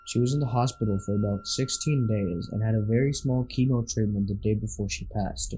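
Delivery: ordinary delivery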